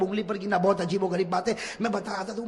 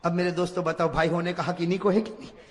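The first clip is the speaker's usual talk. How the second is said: normal talking voice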